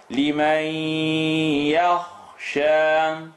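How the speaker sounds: لِمَنْ يَخْشَى with a held nasal on the merged ya; nasal merge. Shown as a demonstration. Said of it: "make a nasaling sound".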